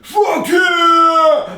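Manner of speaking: high, loud drawn-out scream; exultant shout. Real, not acted. Vlogger cheers while packing in hotel room, screamed. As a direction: scream it out, stretching the last word. Same words muttered, then screamed.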